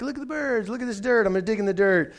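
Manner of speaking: playing his younger self, lost in the birds; distracted little kid. Distracted kid voiced in story.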